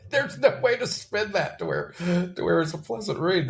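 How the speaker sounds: laughing. Real, not acted. Laughing while commenting on poem.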